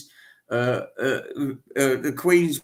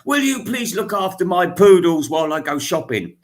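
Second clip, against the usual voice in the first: a hint of a demanding posh lady, louder; lightly mock the grand lady barking orders; light send-up of a posh shopper; 4 semitones higher, 6 dB louder than usual. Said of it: "Lady Heaton, you know, when she goes out to the shops".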